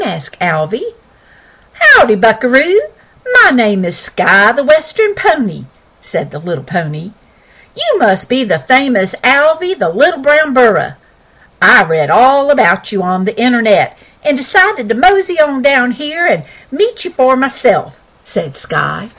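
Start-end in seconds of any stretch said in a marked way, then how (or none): 1.7-5.6 higher, louder cowboy pony voice; folksy Western pony, raised and bright; talking pony. Acted. Sky the Western Pony speaks. 7.7-18.0 higher, louder cowboy pony voice; folksy Western pony, raised and bright; talking pony. Acted. Sky speaks.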